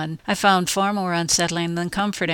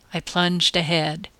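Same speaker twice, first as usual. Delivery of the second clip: plain reading voice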